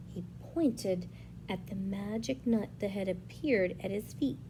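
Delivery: plain reading voice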